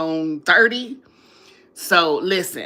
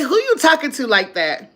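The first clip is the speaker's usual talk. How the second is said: higher, sassy reproach to the phone; sassy scolding; 6 semitones higher, 4 dB louder than usual. Retold or imitated. A woman scolding her phone for back talk, sassy. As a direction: scold Siri for talking back. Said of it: "I say".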